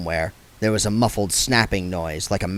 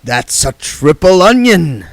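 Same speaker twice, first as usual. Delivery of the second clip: slow, lightly voiced smooth gambler; oily card sharp talking down; Mister Frank's voice; 7 semitones higher, 7 dB louder than usual.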